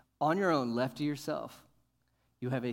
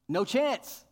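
higher and louder, flat-out dismissal; emphatic; 8 semitones higher, 6 dB louder than usual. Real, not acted. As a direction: slam the door on it.

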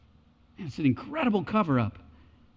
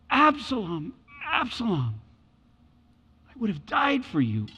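grieving father; 4 semitones higher than usual. Retold or imitated. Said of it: "the affection of a father".

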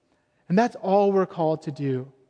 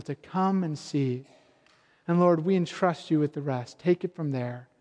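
prayer; 4 semitones lower than usual. Acted. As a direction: pray gently over the congregation. Closing prayer.

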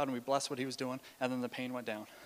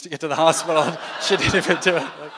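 real laugh breaking into the words, louder; 3 semitones higher, 11 dB louder than usual. Real, not acted. Preacher admitting the wasted ambulance fare, laughing out loud. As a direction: crack up while finishing the sentence. Cracks up at his own story.

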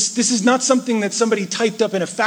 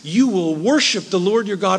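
usual speaking voice

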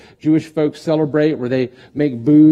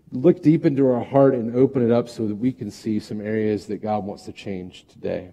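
ordinary talk

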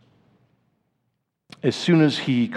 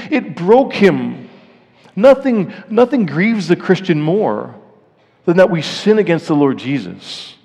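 higher, louder impassioned pulpit preaching; preaching at full force; 6 semitones higher, 6 dB louder than usual. Acted. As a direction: press the grief of sin on the listeners. Climax of the sermon.

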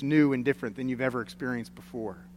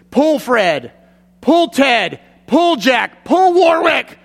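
rising shouted commands, much higher and louder by the end; farmer yelling; 10 semitones higher, 8 dB louder, much slower than usual. Retold or imitated. Farmer shouting to his blind mule, building to a full yell. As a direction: crack each name out like a farmer driving his mule. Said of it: "he yells out and cracks the whip".

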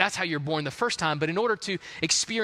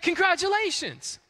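doctor to the mom; 12 semitones higher than usual. Retold or imitated.

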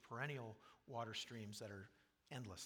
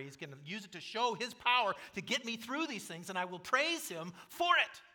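higher and louder, triumphant; preaching at full force; 8 semitones higher, 11 dB louder than usual. Acted. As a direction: declare victory over adversity. Preacher climaxes voiced confession.

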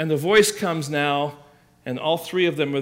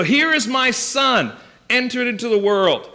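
raised voice, each phrase declared; proclaiming; 7 semitones higher, 5 dB louder than usual. Acted. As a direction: announce it boldly as the Father presenting his Son. Acts out Father presenting his Son.

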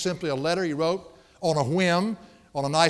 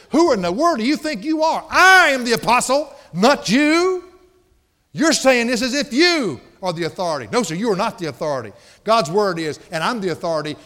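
raised, high-pitched preacher acting an indignant Paul; indignant rebuke; 5 semitones higher, 6 dB louder than usual. Paul to people claiming to be the authority, indignant rebuke. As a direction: challenge them hotly, leaning on the key words.

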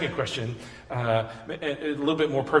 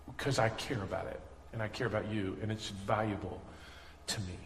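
soft, gentle reassurance, much softer; tender voice of God; 6 semitones lower, 10 dB quieter than usual. Acted. Pastor voicing God's answer to hurting people, soft and tender.

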